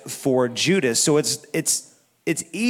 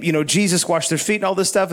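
ordinary delivery

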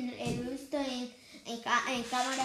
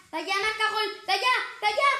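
goal scream; 8 semitones higher, 10 dB louder than usual. Real, not acted. Goal scored, commentator erupts.

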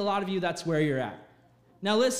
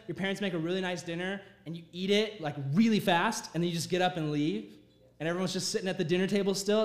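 ordinary talk

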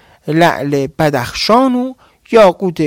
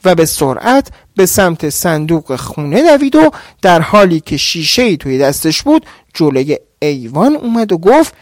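ordinary delivery

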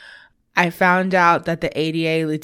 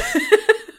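high laugh. Real, not acted. Laughing at joke.

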